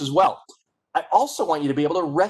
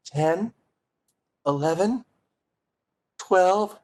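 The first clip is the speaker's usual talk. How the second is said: afraid; 5 semitones higher, much slower than usual. Shown as a demonstration. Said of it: "you're afraid".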